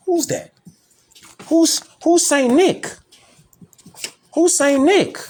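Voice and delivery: high, incredulous mock question; mock disbelief. To viewers, scoffing at the name Saint Nick, high mock disbelief. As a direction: play dumb, as if you've never heard the name.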